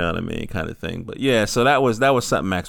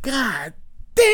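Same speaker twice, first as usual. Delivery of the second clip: amazed god damn; 14 semitones higher than usual. Retold or imitated. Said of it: "And I was like".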